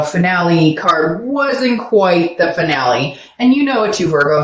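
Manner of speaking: ordinary talk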